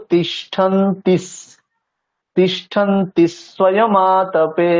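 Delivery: slow তিষ্ঠন্তিস with the s added before স্বয়মাতপে; extra s demo. Shown as a demonstration. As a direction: stretch তিষ্ঠন্তিস, joining the s to the word before. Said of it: "আমরা কেমন উচ্চারণ করব?". (তিষ্ঠন্তিস ধীরে, স আগের সাথে জুড়ে)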